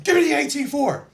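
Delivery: high, loud battlefield demand; urgent retold yell. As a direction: call for a weapon under fire. Retold demand for weapon in firefight.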